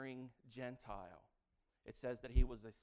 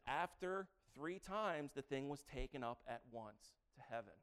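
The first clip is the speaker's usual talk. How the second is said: ordinary talk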